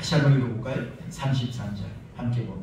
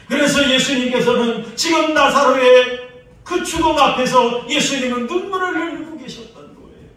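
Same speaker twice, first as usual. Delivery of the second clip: rising, louder, higher preaching up to a shout; full-force preaching; 10 semitones higher, 13 dB louder than usual. Acted. Pastor pressing the prophet's tears on the church in full-force preaching. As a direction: preach it to the rafters.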